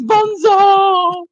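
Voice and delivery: drawn-out, high call; mock cry. Reading a listener's chat message aloud, mock cry.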